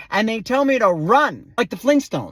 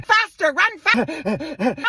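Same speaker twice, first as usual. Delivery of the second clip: high mock trainer shout; imitated high-pitched urging; egging on; 8 semitones higher, much slower than usual. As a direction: push the runner on, pitched way up.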